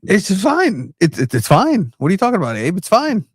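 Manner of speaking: high-pitched teasing protest. Acted. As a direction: squeak it's fine in mock modesty. Downplays friend's Spanish teasingly.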